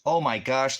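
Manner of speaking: high, loud sarcastic gasp; mock surprise. Real, not acted. Mocking a commenter with loud fake shock. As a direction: act shocked to mock him.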